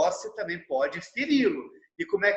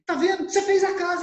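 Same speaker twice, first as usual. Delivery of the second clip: much higher and louder, building to a cheering shout; excited praise; 11 semitones higher, 4 dB louder, faster than usual. Retold or imitated. Father cheering his son for drawing the house, excited high shout. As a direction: cheer the kid on like he just won.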